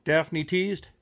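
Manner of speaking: usual speaking voice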